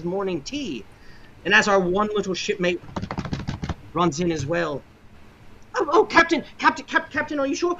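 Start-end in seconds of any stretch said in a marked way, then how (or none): 5.7-7.8 put-on high, breathless young boy fretting over the captain; a frantic young cabin boy calling out; panicky cabin boy. Cabin boy rushes to his fallen captain, high and frantic.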